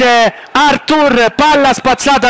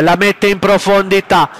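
normal talking voice

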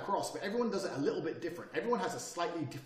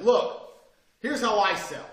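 loud, higher half-yell; 4 semitones higher, 10 dB louder, much slower than usual. Retold or imitated. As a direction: pitch it hard in the meeting. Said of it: "I was half yelling".

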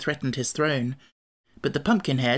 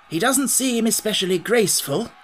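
musing, pitched up; 7 semitones higher than usual. A narrator's reading. Scarecrow sizes up the sawhorse, musingly. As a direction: think aloud in a lifted voice. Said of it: "he remarked musingly".

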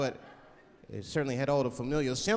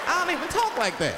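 high, loud put-on protest; mock phony voice pushed way up; pressured fake voice; 12 semitones higher, 5 dB louder than usual. Made fake by social pressure, protesting in a high put-on voice. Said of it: "pressure make me talk different".

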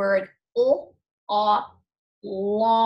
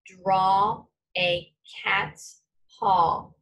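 slow dictation; much slower than usual. Shown as a demonstration. Dictating a sentence to class.

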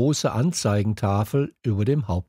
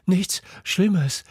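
panting; 8 semitones higher than usual. A narrator's reading. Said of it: "keuchte ich".

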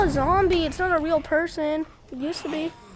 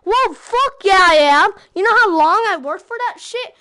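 loud, higher angry yell; angry shout; 4 semitones higher, 11 dB louder than usual. Real, not acted. Yelling at mom about his lost loot, loud and angry. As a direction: snap back loud at mom.

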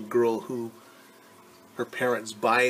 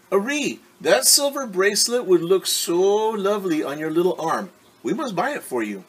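higher, louder gushing parent voices; doting parents; 8 semitones higher, 5 dB louder than usual. Acted. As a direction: coo over her like adoring parents.

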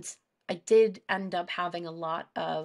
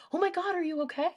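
higher and fast, startled concern; alarmed friend; 10 semitones higher, faster than usual. Retold or imitated. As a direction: blurt it out like a worried friend who just walked in. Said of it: "And she was like".

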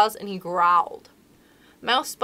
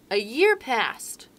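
ordinary delivery, nothing marked

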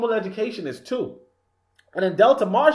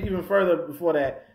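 ordinary talk, nothing marked

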